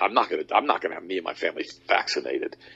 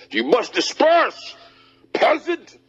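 high, slow, sneering cop; cartoon tyrant cop voice, much higher; mock police voice; slower than usual. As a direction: ham up a pompous officer barking at peasants. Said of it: "The police said".

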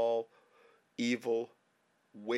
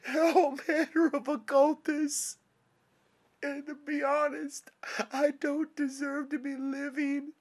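crying; 11 semitones higher, 4 dB louder than usual. Real, not acted. Crying, pleading with God.

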